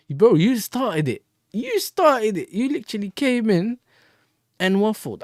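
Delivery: bro, you started it, protest hard; indignant retort. Real, not acted. Arguing with chat hater.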